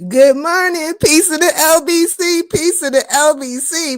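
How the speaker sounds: high, loud hype greeting. Real, not acted. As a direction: call out the greeting big.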